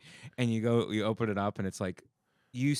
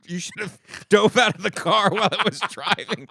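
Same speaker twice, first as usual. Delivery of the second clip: ordinary talk, nothing marked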